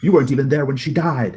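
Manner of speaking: loud, high-pitched outburst; screamed accusation. Acted. Brother accusing sibling over Mother's death, screaming. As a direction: hurl the accusation at full voice.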